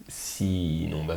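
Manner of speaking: usual speaking voice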